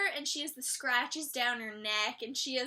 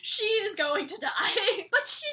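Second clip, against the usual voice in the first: laughing hard, high and loud, slowed down; can barely get it out for laughing; cracking up over the shot werewolf; 7 semitones higher, 8 dB louder, slower than usual. Real, not acted. Laughing at a character being shot.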